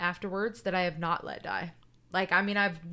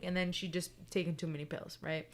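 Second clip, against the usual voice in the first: ordinary delivery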